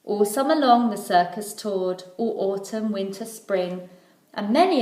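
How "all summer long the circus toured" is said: ordinary delivery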